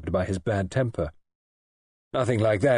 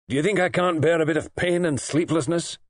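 higher-pitched indignant retort; indignant patient; 7 semitones higher than usual. A narrator's reading. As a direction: refuse the help indignantly. Patient snaps back at nurse.

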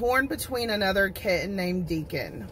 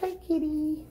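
high, cooing hello to a kitten; pet talk; 8 semitones higher, 4 dB quieter than usual. Real, not acted. Greeting a shelter kitten in a high cooing voice. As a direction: coo it like talking to a pet.

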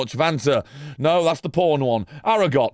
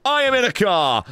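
mock sitcom dad; 7 semitones higher than usual. Streamer joking as his character drives, hammed-up sitcom call. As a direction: play a cheesy sitcom husband. Playful game commentary.

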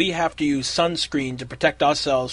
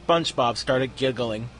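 usual speaking voice

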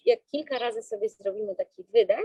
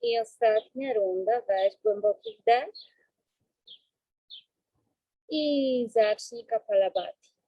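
slow, calm breathing-coach voice; guided breathing. Acted.

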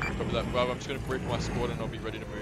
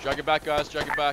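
loud urgent commands; shouting orders over battle; 4 semitones higher, 8 dB louder than usual. Real, not acted. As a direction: bark the order over gunfire. Firefight, casualty to be dragged.

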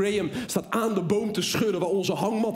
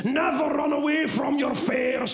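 hammed-up instructor shouting; shouting guide; 4 semitones higher, slower than usual. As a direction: bark orders at the scared father and son. Guide [name] yelling at them.

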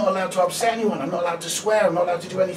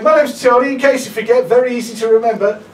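loud, higher, over-bright compere patter; cheesy host voice; 6 semitones higher, 11 dB louder than usual. Retold or imitated. Comedian imitating the cruise director welcoming passengers, cheesy compere voice. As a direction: ham up a cruise-ship host welcoming the crowd.

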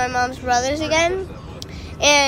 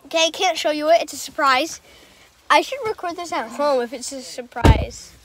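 ordinary delivery

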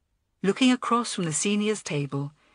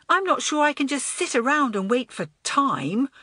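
furious; 7 semitones higher, 3 dB louder than usual. A narrator's reading. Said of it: "exclaimed Elizabeth furiously".

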